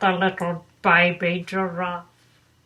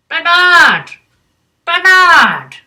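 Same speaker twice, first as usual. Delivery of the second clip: loud, high calling across the dark; 7 semitones higher, 10 dB louder, slower than usual. Acted. Otters calling for the missing Bernard, loud. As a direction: call out searching for him.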